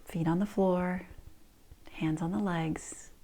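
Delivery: slow soft whisper. Shown as a demonstration. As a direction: hush them gently, slowly. Guides practice in whisper.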